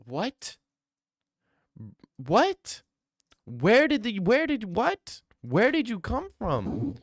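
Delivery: high-pitched incredulous outburst; baffled disbelief. Real, not acted. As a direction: pitch shooting up in real bafflement. Baffled by a viewer's chat message.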